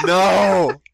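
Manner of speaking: loud, high anguished cry; dramatic shout. Pretend. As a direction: wail it like a tragic poet. Dramatic slam poetry outburst.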